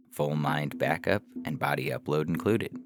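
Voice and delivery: ordinary delivery, nothing marked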